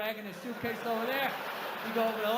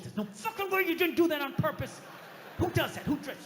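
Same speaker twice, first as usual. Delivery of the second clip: raised, indignant rant to himself; seething anger; 6 semitones higher than usual. Retold or imitated. Stewing while walking around New York, angry outburst. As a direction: burst out higher from muttering into outrage. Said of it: "I'm feeling this anger".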